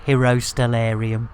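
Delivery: low flat "Hero Stellarium" like a ship computer; robotic computer call. Pretend. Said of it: "Computer, a shout out".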